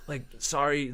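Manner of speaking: usual speaking voice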